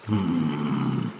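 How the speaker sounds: low musing troll hum; a deep thinking hum. Acted. Troll weighs the offer, low hum.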